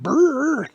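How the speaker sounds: loud, high trilled brrr; spinning-nose noise. Acted. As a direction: trill it like a whirling cartoon nose. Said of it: "and his nose went".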